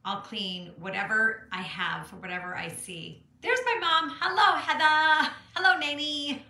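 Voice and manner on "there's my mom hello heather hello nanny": high, loud happy hello; delighted, raised-voice greeting; excited greeting. Real, not acted. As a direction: call out to family, pitched way up. Calls greetings as her mom appears.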